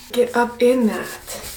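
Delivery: slow, drawn-out murmur; slow ASMR murmur. Shown as a demonstration. To viewers enjoying the scrubbing sounds, slow murmur. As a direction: stretch each word out lazily. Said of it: "auditory experience".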